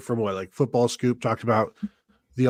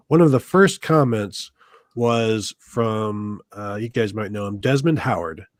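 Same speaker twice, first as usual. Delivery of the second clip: usual speaking voice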